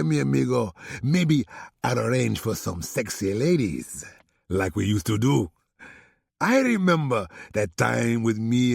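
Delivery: courteous supplier, hint of a Spanish accent; Alejandro's accent. Alejandro thanks LC on the phone in a light Spanish accent.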